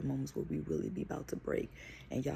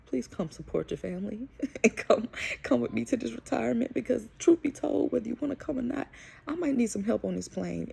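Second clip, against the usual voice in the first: high, louder mock begging; hammed-up whiny plea, climbing higher; pleading with mom; 9 semitones higher, 4 dB louder than usual. Daughter begging her mom to come help, high pleading whine. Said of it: "I was asking my mom".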